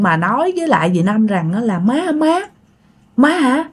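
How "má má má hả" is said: female voice. Retold or imitated. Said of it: "cái giọng thì giọng nữ nha".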